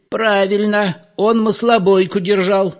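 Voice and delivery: put-on high-pitched elderly voice agreeing; old villager. A narrator's reading. Old villager voiced in table argument.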